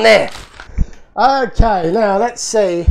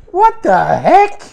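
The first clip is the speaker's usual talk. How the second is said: disbelief; 8 semitones higher, 4 dB louder, slower than usual. Real, not acted. Still reacting to the car.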